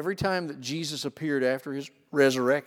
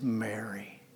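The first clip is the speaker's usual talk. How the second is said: Mary said softly, much quieter; tender call; 4 semitones lower, 9 dB quieter than usual. Acted. Jesus tenderly saying Mary's name so she knows him, soft. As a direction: gently call her by name. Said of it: "She knew by the way he had said the name".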